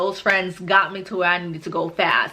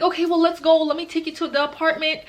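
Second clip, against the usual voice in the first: worried friend; 8 semitones higher than usual. Retold or imitated. Friend hurries to help.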